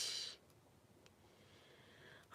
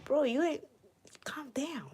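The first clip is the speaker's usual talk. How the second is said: whisper; 8 semitones higher, 3 dB quieter than usual. Real, not acted. Whispered at rival.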